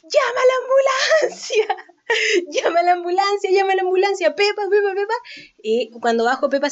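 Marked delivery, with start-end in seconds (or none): 0.0-5.1 shouting across the gym for someone to call an ambulance; panicked shout. Retold or imitated. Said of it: "empecé a gritarle".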